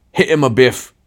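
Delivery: big bully egging others on; big man. A narrator's reading. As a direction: call for a thump, roughly. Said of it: "said the big man".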